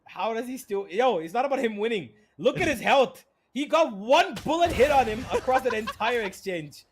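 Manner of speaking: high, loud disbelief; incredulous rant. Real, not acted. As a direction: hammer home how absurd it is. Ranting about one bullet hit.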